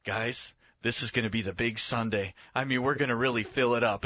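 lightly voiced leader briefing the team; Jesus as megachurch pastor. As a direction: voice dropped from the excited narration. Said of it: "And Jesus goes".

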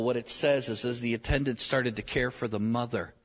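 usual speaking voice

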